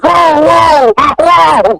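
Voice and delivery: high, drawn-out cries to halt; imitated alarmed shout. Director halts a rough improv in his office, alarmed. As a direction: act out breaking up a fight, pitch way up.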